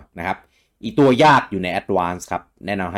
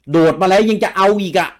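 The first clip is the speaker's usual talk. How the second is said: exasperated outburst; 10 semitones higher, 6 dB louder than usual. Retold or imitated. Frustrated gamer complaint.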